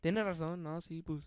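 soft, hesitant string of yeses in Spanish; mock agreement. Retells feigned agreement with coworker.

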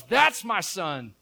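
loud, slow proclamation; preacher voicing a father's proud claim; emphatic fatherly declaration. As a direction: declare it loudly, drawing out each word. Father recognises his returning son.

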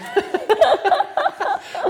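high burst of laughter. Real, not acted. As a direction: laugh at mum's indifference. Laughs about her mum.

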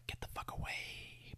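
barely audible whisper, all breath; whispered warning. Acted. Husband as buffer warning off a stranger, in an acted whisper. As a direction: whisper it under your breath.

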